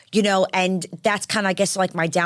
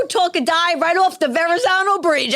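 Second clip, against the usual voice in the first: high, loud hammed-up cheer; mimicking her own clumsy speech, pitched up; mock butchered speech; 10 semitones higher, 4 dB louder than usual. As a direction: overdo the flubbed shout-out. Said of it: "I butchered that. I went".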